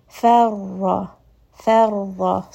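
Farra with a light fa, then a full heavy doubled ra. Shown as a demonstration. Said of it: "you can hear that I'm going from that light fa to the heavy ra". (fa light, ra heavy)

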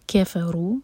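ordinary talk, nothing marked

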